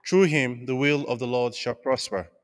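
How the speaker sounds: usual speaking voice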